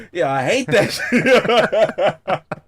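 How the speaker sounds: slow, laughing complaint; real laughter while griping. Real, not acted. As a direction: laugh it out in disbelief. Laughing about his kid.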